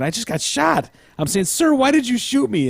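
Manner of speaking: high, stunned voice; quoting Kinsey. Retold or imitated. Host reading the therapist's account, high stunned voice. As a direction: ask it high in disbelief. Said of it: "I'm thinking".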